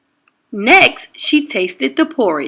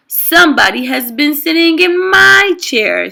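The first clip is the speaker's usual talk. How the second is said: indignant Mama Bear; 6 semitones higher, 4 dB louder than usual. A narrator's reading. Mama Bear finds chair.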